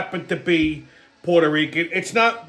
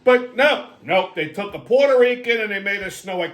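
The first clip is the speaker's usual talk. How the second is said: usual speaking voice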